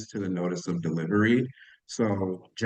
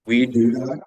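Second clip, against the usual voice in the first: muffled, like through water; distorted, muffled mic sound; underwater; 4 dB louder than usual. Real, not acted. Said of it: "You sound like you're underwater".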